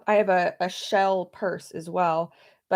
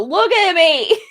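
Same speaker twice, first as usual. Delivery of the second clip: playful shout; 10 semitones higher, 10 dB louder, slower than usual. Retold or imitated. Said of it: "I go".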